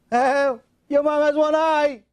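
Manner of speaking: high, drawn-out mocking jeer; schoolyard taunt. Kindergarteners making fun of his mom, high singsong taunt. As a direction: stretch it out like a teasing kid. Kids mock the boy's mother.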